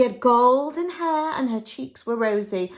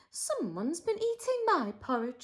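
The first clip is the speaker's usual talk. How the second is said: Mother Bear; 6 semitones higher, 8 dB quieter than usual. Acted. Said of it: "said Mother Bear".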